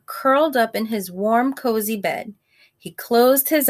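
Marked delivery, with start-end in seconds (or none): none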